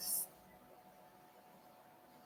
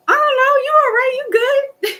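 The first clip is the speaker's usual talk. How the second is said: very high, loud squeaky voice; mock uncertain squeal; 5 dB louder than usual. Teasing friend who asks if his energy is all right, high loud squeal. Acted reply to his anxious question.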